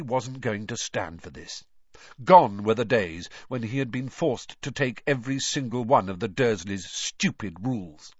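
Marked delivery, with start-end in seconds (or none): none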